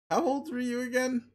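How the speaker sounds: high mocking sing-song; high-pitched condescending jab. Narrator mocking a grown man.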